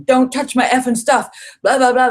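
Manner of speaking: high, loud imitated angry shouting; retold angry yell. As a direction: bark it like the angry man. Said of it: "and swearing".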